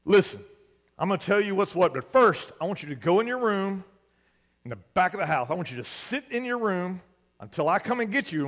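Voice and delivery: loud, stern dad laying down the law; angry father lecture. Retold or imitated. Furious dad sends his son to his room, loud and stern. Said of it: "And Dad says".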